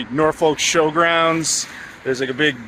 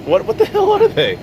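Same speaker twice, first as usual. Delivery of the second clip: high, incredulous what the hell; real shock at the odd name; baffled disbelief; 3 dB louder than usual. Real, not acted. Host baffled by Mister Porky snack, incredulous.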